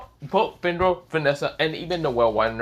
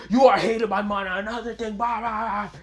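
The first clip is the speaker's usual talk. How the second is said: high mock-screamed villain rant, trailing into babble; 7 semitones higher than usual. Vector pushed back by Asta, high mock screaming. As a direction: rant high, letting the volume drop into blahs. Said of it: "He's just screaming out a bunch of things".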